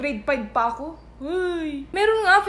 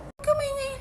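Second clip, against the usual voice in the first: high-pitched, softer taunt; mocking mimicry; 11 semitones higher, 5 dB quieter, faster than usual. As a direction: parrot the order in a silly high voice. Mocks the repeated order for quiet.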